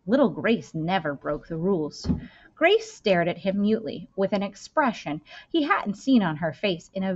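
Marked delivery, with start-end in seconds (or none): none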